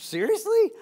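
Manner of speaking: shocked donkey can't believe it; incredulous. Retold or imitated. Donkey retorts in disbelief.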